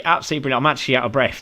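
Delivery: ordinary delivery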